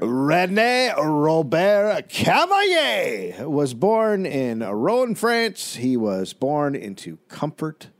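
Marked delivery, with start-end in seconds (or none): 0.0-5.8 put-on slow, louder, raised pompous French accent; mock French accent. Opening the story in a hammed-up French accent. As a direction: roll the French name out like a proud Frenchman. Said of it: "Get your French accent ready".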